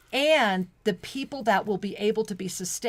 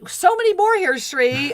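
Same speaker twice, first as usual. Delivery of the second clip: delighted; 10 semitones higher, 8 dB louder than usual. Real, not acted. Delighted at many messages.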